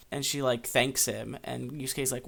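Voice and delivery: usual speaking voice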